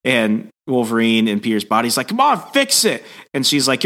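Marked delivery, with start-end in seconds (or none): 2.1-3.0 high-pitched pleading insistence; exasperated demand. Retold or imitated. Swapped Wolverine begging Jean to undo it, exasperated demand. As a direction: mock-desperate urging. Acted plea to fix swap.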